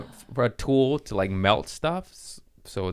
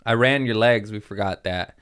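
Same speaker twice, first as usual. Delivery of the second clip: normal talking voice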